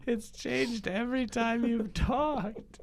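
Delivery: put-on high, curious kid voice; a wide-eyed kid needling Santa; child's voice. Child up late on Christmas Eve questioning Santa, high kid voice.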